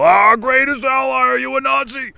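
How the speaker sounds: high, loud hysterical caricature; mock unhinged outburst. Supposed adult reacting to an Israel question, mock hysterical. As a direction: screech it like a panicked zealot.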